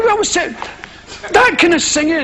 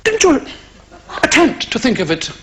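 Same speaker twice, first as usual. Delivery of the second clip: preacher father. Acted. Father urging son towards the spiritual, patronising preacherly tone. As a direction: implore the boy like a pompous preacher. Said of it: "I said to my son".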